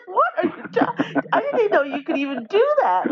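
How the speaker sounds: high, laughing disbelief; mock astonishment. Ontario friends amazed by the sudden accent, laughing mock astonishment. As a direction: imitate flabbergasted friends through laughter.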